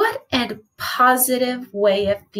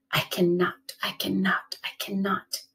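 softer and softer to a whisper; fading chug; 4 semitones lower, 7 dB quieter than usual. A narrator's reading. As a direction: chant it fading away into the distance.

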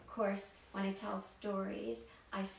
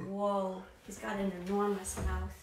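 mock amazement; 4 dB louder than usual. Reacting to a huge mouth.

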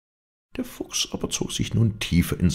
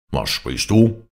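loud wolf demand; wolf's moderately shouted question; angry shout; 5 dB louder, slower than usual. A narrator's reading. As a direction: challenge him with raised voice. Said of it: "schrie der Wolf abermals".

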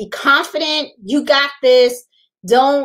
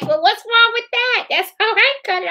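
imitating mom; 9 semitones higher than usual. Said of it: "my mom was like".

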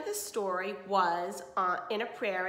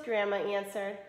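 ordinary delivery

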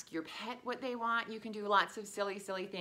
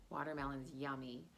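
ordinary delivery

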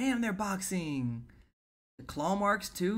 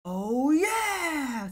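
high, drawn-out "oh yeah"; swooping hyped cheer; excited cheer; 12 semitones higher, 5 dB louder than usual. Real, not acted. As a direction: whoop it in delight. Cheers at the action.

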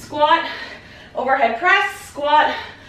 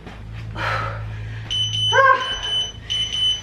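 heavy exhale; 12 semitones higher than usual. Real, not acted. End of hard set.

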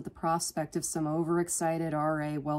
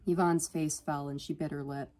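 usual speaking voice

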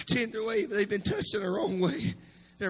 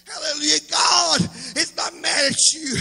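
loud preaching, rising in fervour; fiery preaching; 5 semitones higher, 5 dB louder than usual. Acted. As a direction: preach it out to the whole church. Preaching to the congregation.

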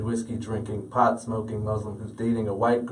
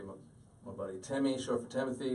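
ordinary talk

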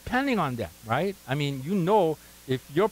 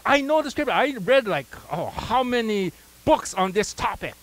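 boastful, pitched-up Miss Know-It-All; 5 semitones higher than usual. Retold or imitated. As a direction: show off like you've read everything. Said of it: "Mister or Miss Know-It-All".

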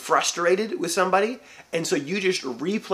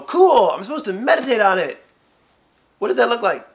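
higher, louder voice of a confused believer; puzzled listener; 5 semitones higher, 6 dB louder than usual. Retold or imitated. A lightly voiced confused churchgoer asking how to meditate. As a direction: play the one who doesn't get it. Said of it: "we look and go".

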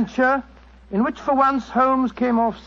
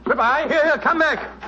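urgent, higher-pitched call; 4 semitones higher than usual. Acted. Watson to the fleeing impostor, calling out urgently. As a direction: call after someone getting away.

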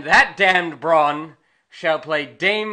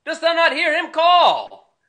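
loud, high shout; 12 semitones higher, 7 dB louder than usual. Acted. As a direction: bellow at him from across the room. Prince shouting at the drawer.

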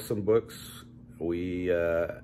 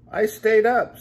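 high, loud proud cry; proud exclaim; 12 dB louder than usual. Acted. Bear telling his friends he stayed up, high proud exclaim. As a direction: burst out with pride.

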